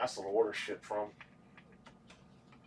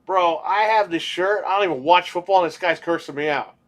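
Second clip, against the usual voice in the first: raised indignant mock protest; retold loud complaint; 5 semitones higher, 6 dB louder than usual. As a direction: complain to the manager, hammed up. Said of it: "and I'm like".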